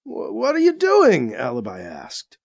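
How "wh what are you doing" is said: startled. A narrator's reading. Waking mid-surgery.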